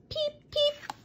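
high squeaky "Peep, peep"; high chick peeps. Acted. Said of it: "twin chicks".

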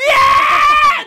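put-on high, loud childish yell of frustration; yelling like a baby. Venting at the super who twisted it off in one go, high baby yell. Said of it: "Just yelling like a baby".

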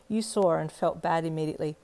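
usual speaking voice

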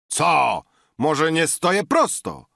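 belligerent retort. A narrator's reading. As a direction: throw it back combatively. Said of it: "odparł wojowniczy Natan". (wojownicza, głośna odpowiedź)

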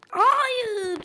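sharp high "hayır"; a sudden jolt of alarm; alarmed cry. Real, not acted. Panic as character falls.

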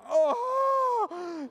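very high, loud mock wail; long exasperated "oh!"; anguished cry. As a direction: howl in comic frustration. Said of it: "And you're like".

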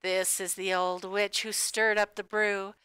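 ordinary delivery, nothing marked

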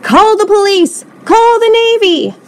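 loud, high-pitched cries for help; panicked shriek. A narrator's reading. Coach calls for help against the creature, shrieking. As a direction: raise the alarm at full pitch. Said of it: "shrieked the coach".

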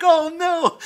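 high, loud mock wail; acted panicked yell. Boy just bitten by snake, yelling. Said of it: "I'm screaming and yelling".

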